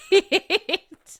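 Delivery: loud, high-pitched laugh; burst of laughter. Real, not acted. Laughing at her own French apology, high burst.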